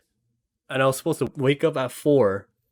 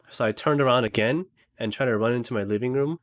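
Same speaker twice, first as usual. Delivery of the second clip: ordinary delivery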